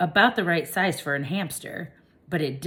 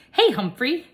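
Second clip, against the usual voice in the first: put-on eager kid; 9 semitones higher, 7 dB louder than usual. A narrator's reading. Kirk calls to hamster.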